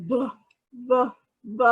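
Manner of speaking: a short, voiced B puffed out alone, like buh; B sound. Shown as a demonstration. Teacher demonstrating the B sound for kids to copy. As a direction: pop the B sound over and over. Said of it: "make that sound a B again".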